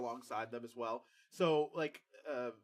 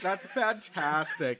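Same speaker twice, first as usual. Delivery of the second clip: slow mock gush; 4 semitones higher, 6 dB louder, much slower than usual. Shown as a demonstration. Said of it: "mock gushing".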